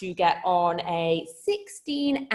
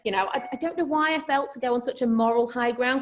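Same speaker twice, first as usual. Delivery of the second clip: usual speaking voice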